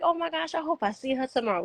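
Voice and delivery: high, eager little voice; high, hopeful self-quote about the maid; hopeful excitement. Retold or imitated. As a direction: sound like you can't wait to see her. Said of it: "And I was just like".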